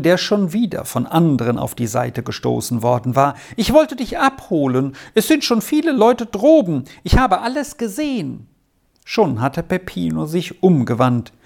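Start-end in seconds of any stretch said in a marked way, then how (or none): 3.6-8.5 put-on high, lively boy's voice; eager calling. A narrator's reading. Boy come to fetch his friend, eagerly calling. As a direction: urge a friend along, pitched up. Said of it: "rief ihm ein Junge entgegen".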